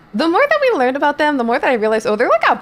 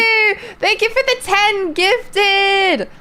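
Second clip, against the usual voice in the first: high, slow, drawn-out thank you; high mock thanks; 9 semitones higher, slower than usual. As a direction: squeak the thanks out slowly.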